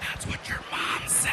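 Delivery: soft unvoiced whisper in the ear. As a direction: breathe it right into his ear. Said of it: "I whisper in his ear".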